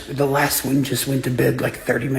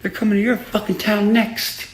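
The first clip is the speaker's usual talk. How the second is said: higher, taunting retold warning; mock warning; 9 semitones higher than usual. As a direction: tease him like you know what's coming. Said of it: "And I said".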